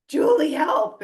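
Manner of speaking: loud, high mock panic; panicked cry for help. Client panicking to her mentor, mock panicked plea. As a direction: call for help in a fake panic. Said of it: "moments of panic, like".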